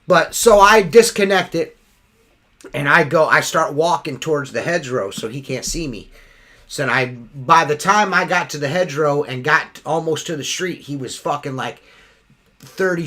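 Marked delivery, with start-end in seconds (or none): none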